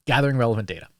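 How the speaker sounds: ordinary delivery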